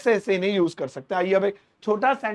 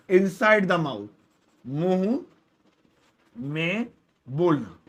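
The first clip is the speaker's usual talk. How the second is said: slow explanation; 2 semitones lower, much slower than usual. Shown as a demonstration. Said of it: "ये होता है मुंह में बोलना".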